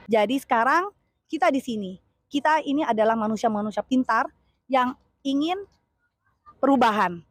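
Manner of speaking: loud, punchy campaign oratory; rally the crowd phrase by phrase; rally speech. Real, not acted. Supporter urging fellow supporters toward change, rousing and loud.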